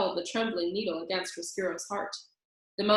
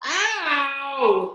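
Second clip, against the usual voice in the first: higher, louder yelp of pain; mock scream; 6 semitones higher, 6 dB louder than usual. A narrator's reading. Roscuro yelps at losing his tail, loud. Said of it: "screamed Roscuro".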